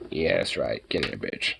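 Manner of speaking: deep gravelly-free low coaxing voice; low mock growl. Coaxing charger cord in, low.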